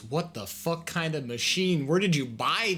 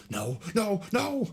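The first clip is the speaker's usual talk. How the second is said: startled cry; 8 semitones higher than usual. Real, not acted. Panicked outcry at machine.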